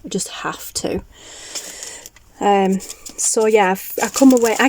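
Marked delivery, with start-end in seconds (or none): 0.0-1.0 hushed, breathless whisper of wanting; whispered gushing. Retold or imitated. Telling viewers about the craft-fair cards, whispered gushing. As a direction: whisper it like a secret craving. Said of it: "So I was like".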